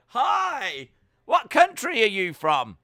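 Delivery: mock nightclub shout, high and loud; shouting over music. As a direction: yell a cheery hello across the dance floor. Greeting a girl in a nightclub.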